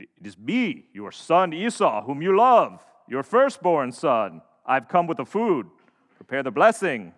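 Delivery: loud, emphatic put-on voice, drawn out in places; unconvincing imitation of brother. Jacob poses as Esau to his blind father, loud fake voice. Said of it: "didn't really sound like you".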